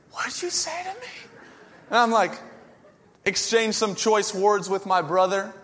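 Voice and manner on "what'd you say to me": much higher and softer squeaky challenge; same puny menace, pulled back to a hiss-less hush; mock tough guy, softer. Repeats challenge, gloves off.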